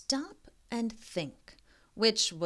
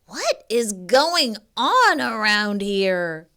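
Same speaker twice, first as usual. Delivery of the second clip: exasperated groan; 8 dB louder than usual. Acted. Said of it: "groaned the exhausted".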